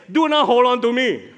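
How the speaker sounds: loud, high firm order; acted forceful rebuke; emphatic command. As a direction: proclaim it forcefully, each word weighted. Acted Jesus telling Mary off.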